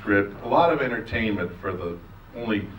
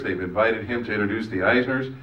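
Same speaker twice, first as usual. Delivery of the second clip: ordinary talk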